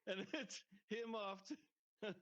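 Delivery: talking through laughter, high and slow; words broken by laughs; laughing. Real, not acted. Laughing while retelling scene.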